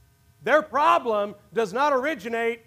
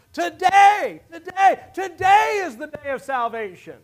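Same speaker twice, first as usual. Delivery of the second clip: louder, higher repeated "today" climaxing; building preached shout; 3 semitones higher, 5 dB louder than usual. Real, not acted. Preacher urging the congregation to salvation, rising shout. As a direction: hammer "today" until it rings out.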